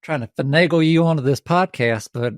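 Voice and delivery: turned-up Southern country twang. Pretend. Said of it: "Let me turn the twang up".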